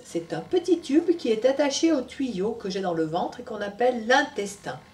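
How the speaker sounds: put-on lively storytelling voice for children; story narration. A narrator's reading. Storyteller telling Tom's story to children, animated. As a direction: read it to little ones.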